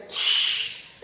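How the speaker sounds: breathy unvoiced shush; hushing. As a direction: hush someone quickly. Hushing friend as Wolfie enters.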